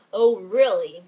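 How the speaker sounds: loud, sarcastic "Oh, really?"; a hammed-up indignant retort; mock indignant. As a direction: scoff back at the cat. Fogle answers the cat.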